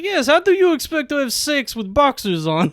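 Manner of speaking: high mock Russian woman; thick Russian accent. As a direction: imitate her scolding in a Russian accent. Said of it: "she has, like, a thick Russian accent".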